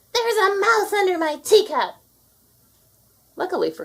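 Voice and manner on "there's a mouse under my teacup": high, loud panicked shriek; screaming. A narrator's reading. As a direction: shriek it out in disgust and fright. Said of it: "screamed Anastasia".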